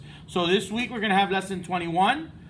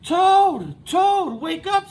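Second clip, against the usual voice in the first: high, loud wake-up call; 11 semitones higher, 7 dB louder, slower than usual. Acted. Frog wakes sleeping Toad, high loud call. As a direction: call him awake from across the garden.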